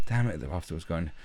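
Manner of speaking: ordinary delivery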